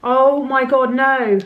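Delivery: sudden alarmed gasp of realisation; oh no, I'm late; shocked. Real, not acted. Realises she missed appointment.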